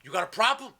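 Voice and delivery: loud, high re-enacted challenge; confrontational challenge. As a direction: act out squaring up to your sister. Acted challenge to sister in quarrel.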